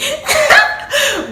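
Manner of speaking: sudden high giggle after the apology; high burst of laughter. Real, not acted. Vlogger laughing off her apology to her parents, high giggle.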